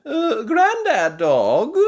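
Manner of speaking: high, loud, slow call; calling granny. A narrator's reading. Granny Pig calls to Grandad Dog, high and slow. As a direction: call over to the neighbour. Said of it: "calls Granny Pig".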